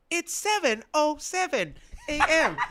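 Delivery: high, slow robotic clock voice, laughing through it; mock an old nineties alarm clock, slow and high; imitating a talking clock. Friend recalling nineties clocks, imitating the clock voice slowly and high.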